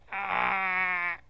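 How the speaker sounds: loud, high sustained "ah"; scream. Acted. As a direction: scream out suddenly. Startled scream in scene.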